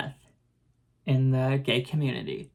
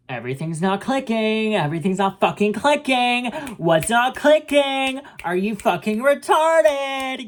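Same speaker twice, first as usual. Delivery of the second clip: building high, loud, exasperated rant; angry outburst; 8 semitones higher, 5 dB louder than usual. Acted. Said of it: "I'm angry all the fucking time".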